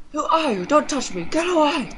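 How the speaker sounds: high, loud frightened cries; confused mother begging not to be touched; panicked pleading. Acted. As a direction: push the stranger away in fear.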